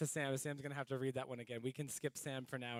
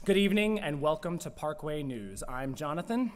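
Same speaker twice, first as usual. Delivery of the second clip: louder, bright newscaster read; mock news anchor; 4 semitones higher, 13 dB louder than usual. Co-host opens their pretend Parkway News show as an announcer. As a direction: open the show like an evening anchor.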